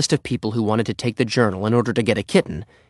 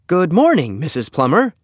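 raised, firm formal hello; businesslike greeting; 7 semitones higher, 4 dB louder than usual. Acted. Henry greets a customer at her door, higher and businesslike. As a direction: greet her like a little salesman. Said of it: "in his most businesslike voice".

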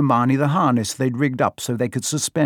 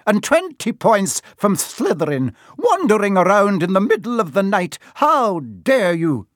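high-pitched, indignant telling-off; scold, stretching out the final outrage; McGonagall; 8 semitones higher than usual. A narrator's reading. Professor punishing Malfoy for wandering, shouting indignantly. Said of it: "she shouted".

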